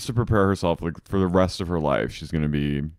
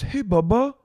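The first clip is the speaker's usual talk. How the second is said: high-pitched imitation of a pet-name hello; cutesy mock greeting; 12 semitones higher than usual. Acting out Tom greeting Katie, cutesy mock greeting. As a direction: lift it high, mocking a sweetheart's greeting.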